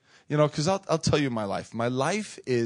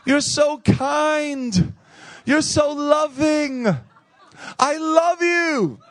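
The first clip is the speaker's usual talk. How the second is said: high drawn-out loving gush, hammed-up; gushing clerk; 11 semitones higher, much slower than usual. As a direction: coo it like a smitten stranger. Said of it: "She says".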